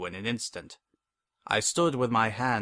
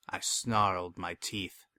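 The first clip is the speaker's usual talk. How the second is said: ordinary delivery, nothing marked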